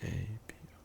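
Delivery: letters whispered under the breath; whispered spelling. Real, not acted. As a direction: murmur the letters to yourself while typing. Spelling out a search quietly.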